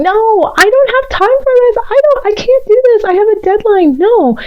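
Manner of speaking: high, whiny mock protest of an overworked person; mock grumbling.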